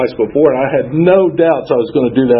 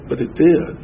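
ordinary delivery

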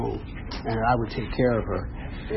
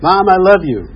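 loud, higher "I love you"; retold dogged affection; insistent declaration; 5 semitones higher, 8 dB louder than usual. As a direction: push the words out, raised voice. Repeats declaration to reluctant mother.

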